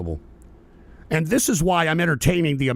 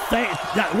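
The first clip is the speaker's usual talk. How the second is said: ordinary delivery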